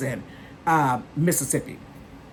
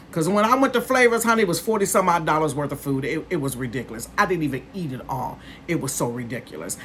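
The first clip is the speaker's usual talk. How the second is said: fast; 2 semitones lower, faster than usual. Real, not acted. Rushed aside about overspending.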